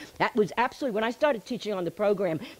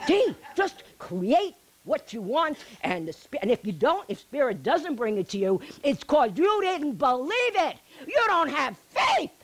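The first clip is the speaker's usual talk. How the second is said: mocking; 6 semitones higher than usual. Mocking simplistic spiritual advice.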